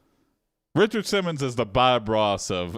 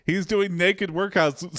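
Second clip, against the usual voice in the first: alarmed; 10 semitones higher, slower than usual. Real, not acted. Reacting to naked workouts.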